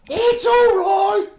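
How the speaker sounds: very high, slow, loud mum reply; hammed-up mum reassurance; mum's voice. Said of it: "I'm doing your voice".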